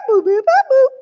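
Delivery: high, loud machine boops; playful burst of electronic blips; scanner beeps. Pretend. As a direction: imitate a barcode scanner going off. Imitates her barcode machine.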